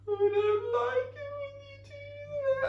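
high, slow, tearful wail; hammed-up sobbing plea; mock crying. Shown as a demonstration. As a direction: overplay the crybaby whine. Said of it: "And I start crying".

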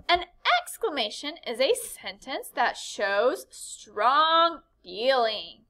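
ordinary talk, nothing marked

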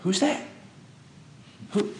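high, wondering "Who's that?"; a puzzled angel asking; lightly voiced surprised angel; curious angel. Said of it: "An angel looks up and goes".

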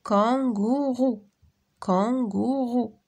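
Kangourou stretched slowly into three even beats; syllable by syllable. Shown as a demonstration. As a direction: draw out each syllable of Kangourou. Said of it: "Frappe les syllabes dans tes mains".

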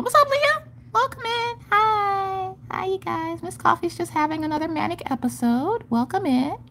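very high, small cartoonish greeting; put-on squeaky voice. Streamer welcoming viewers mid manic episode, squeaky voice. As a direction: pipe up in a silly falsetto.

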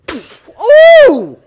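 long, very high, loud howl of pain; pained yelp. Acted. Boy burning his nose on the lighter, pained yelp. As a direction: howl as if suddenly burned.

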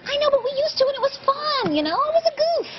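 high whiny protest about the Valentine; high whine. Acted. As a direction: squeak it out like a hurt kid. Defensive whining.

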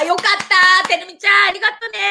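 high, sing-song relieved thanks; 大喜び. Real, not acted. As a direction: call out gratefully with soaring pitch. Delighted thanks to a viewer. (高く歌うように喜んで感謝)

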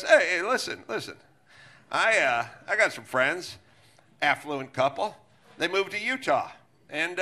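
mock governor trailing off into a story; Gavin Newsom impression. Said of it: "And he goes".

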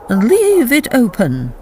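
put-on slow, firm old woman's order; dying governess ordering the Baroness, slow and firm; weak but commanding. A narrator's reading. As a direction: command despite frailty. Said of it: "carried an air of command".

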